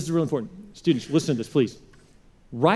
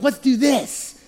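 higher, eager mock teen voice; reckless teen; 7 semitones higher, much slower than usual. Pastor plays a fifteen-year-old to the youth, mock eager voice. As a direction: pitch it up like a reckless kid. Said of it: "you're like".

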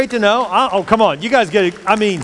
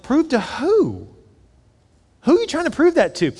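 pitched-up mock-incredulous comeback; incredulous challenge; 8 semitones higher than usual. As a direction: ask it pitched up in disbelief. Preacher voicing an imagined retort.

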